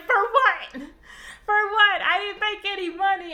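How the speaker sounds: put-on high, loud retelling of an upset girl; indignant, distressed plea, acted out; mock tearful protest. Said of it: "on the verge of tears".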